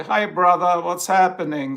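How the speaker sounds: casual slang greeting. Shown as a demonstration. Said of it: "I'm just learning slang".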